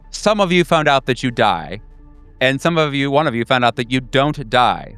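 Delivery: ordinary talk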